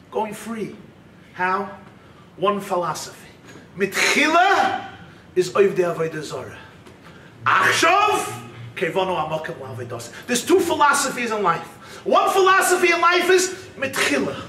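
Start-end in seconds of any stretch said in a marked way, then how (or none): none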